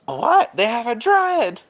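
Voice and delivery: high, loud delighted squeal; excited. Acted. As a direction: shriek it out with glee. Creature thrilled at the druid.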